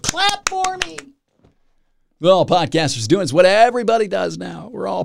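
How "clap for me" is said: high, thin mock plea for applause; mocking clap request. Real, not acted. Podcaster mocking himself as a dancing performer, high sarcastic plea. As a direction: beg sarcastically in a squeaky high voice.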